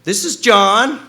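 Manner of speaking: high and loud, rising at the end; squeaky loud reply on the phone. Retold or imitated. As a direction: imitate the high phone voice again. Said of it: "this high voice go".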